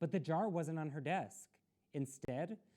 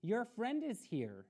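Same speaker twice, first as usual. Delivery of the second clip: calling up; 8 semitones higher, 4 dB louder than usual. A narrator's reading. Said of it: "Mother called up".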